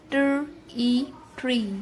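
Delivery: ای stretched after ٹر, then ٹری; long drawn E. Shown as a demonstration. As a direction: hold the vowel long. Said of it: "ایک لمبی اور کھینچی ہوئی آواز". (لمبی ای کے ساتھ ٹری)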